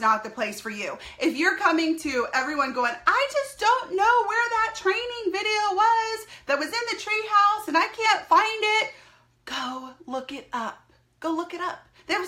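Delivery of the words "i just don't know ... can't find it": a helpless newbie whining about a lost video, mock whine; whiny team member. Mocking a confused team member.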